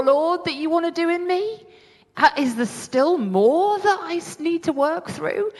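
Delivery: high, rising plea to the Lord; questioning God. Retold or imitated. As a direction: lift the voice high, asking God. Acts out her question to God.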